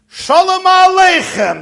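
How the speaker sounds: high, loud, drawn-out hail; old man's hearty called-out greeting; booming greeting. Acted. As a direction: hail him slowly at full voice. Old man calls out greeting.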